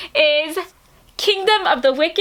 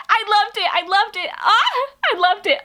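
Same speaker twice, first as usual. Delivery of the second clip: high, giddy repeated gushing; overexcited fan, pitch jumping on the ah; too excited; 7 semitones higher than usual. Real, not acted. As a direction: squeal it out, barely containing yourself. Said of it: "I'm getting too excited".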